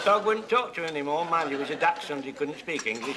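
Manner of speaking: usual speaking voice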